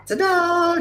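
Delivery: high sing-song ta-da; ta-da flourish. Acted. Showing off the fixed audio like a magician, high ta-da. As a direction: present it like a magic trick.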